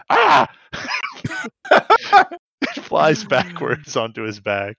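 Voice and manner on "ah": mock yelp. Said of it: "and he goes".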